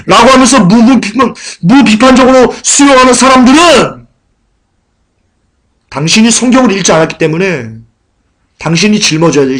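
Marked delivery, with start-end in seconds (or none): 0.0-4.1 loud, high-pitched sermon delivery; raised-voice pulpit exhortation; forceful preaching. Real, not acted.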